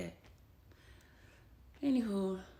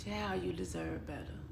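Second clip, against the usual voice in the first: put-on tender to her baby; 4 dB quieter than usual. Speaking to her deceased baby.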